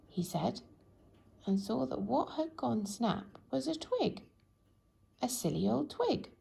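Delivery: ordinary delivery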